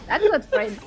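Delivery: ordinary talk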